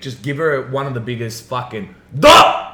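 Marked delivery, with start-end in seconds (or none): 2.2-2.6 high, loud comic "Duh!" hit noise; mock punch sound. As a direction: bark the hit like a cartoon. Imitating a blow to the head.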